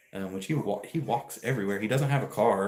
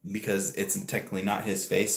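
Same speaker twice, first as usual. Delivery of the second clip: ordinary talk, nothing marked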